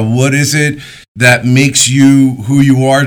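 ordinary talk

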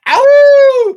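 high loud whoop; excited whoop. Real, not acted. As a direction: let out a party whoop. Hype shout at podcast close.

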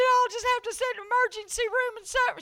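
high crybaby whine; crying. Retold or imitated. Refusing the hospital while in pain, crying. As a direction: wail in stubborn protest. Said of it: "I was crying".

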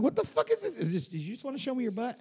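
incredulous protest. Retold or imitated. Retelling his startled protest at the bar.

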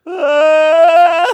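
loud, high imitated crying "aah"; mock weeping wail. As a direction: wail like a bawling kid, hammed-up. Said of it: "like, weeping".

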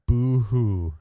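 hammed-up pretend whimper; fake crying. Mock sob in read note.

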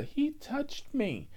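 mock accuser voice. Mock accuser after he touched.

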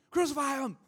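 high, loud mock crowd cry; offended churchgoer shouting like the crowd at the cross; mob shout. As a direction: hurl it like an angry mob. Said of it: "somebody didn't say hi".

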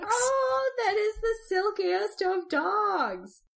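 high, slow doting coo. Real, not acted. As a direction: gush over the puppy. Cooing over a dog photo.

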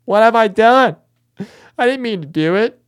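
high, loud, panicked confession; hammed-up anguished outcry of guilt; melodramatic horror. Snacker playing a guilty werewolf, melodramatic horror. Replays the werewolf's horrified regret.

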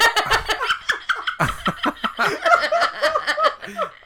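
laughing too hard to talk; long shrieking laugh; long high laugh. Real, not acted. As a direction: wheeze with laughter. Laughing at own story.

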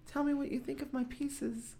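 high, soft pretend woman's voice; mock imitation of the woman. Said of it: "that's not really how she said it".

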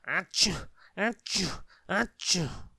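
three high, loud sneezes. A narrator's reading. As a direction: sneeze three times hard. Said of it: "sneezed Mister Sneeze".

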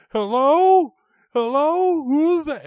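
very high, loud calling; Mister Spindle on phone. Retold or imitated. Mister Spindle still on the line, high bewildered shouting. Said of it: "Mister Spindle's still on. It's like".

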